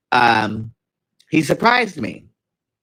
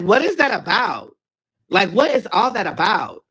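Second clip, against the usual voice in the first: baffled; 6 semitones higher than usual. Retold or imitated. Confronting boyfriend after.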